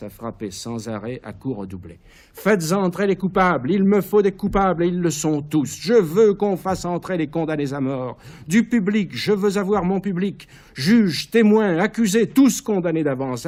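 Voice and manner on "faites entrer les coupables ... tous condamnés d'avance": high, loud, commanding frenzy; frenzied shouting. Acted. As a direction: bellow orders over the gong. Caligula frappe le gong et ordonne. (cris frénétiques et impérieux)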